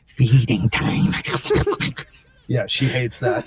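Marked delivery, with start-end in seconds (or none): 0.1-1.1 slow, creepy creature voice. Shown as a demonstration. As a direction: draw it out like a monster. Creature voice used to scare wife.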